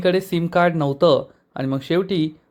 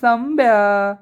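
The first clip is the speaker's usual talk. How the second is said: bright, higher joyful call of the name; happy call; 6 semitones higher, much slower than usual. Retold or imitated. Girls happily greeting Sambya at the garage, excited call. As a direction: call out the name, delighted to see him. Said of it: "एकदम आनंदात म्हणतात".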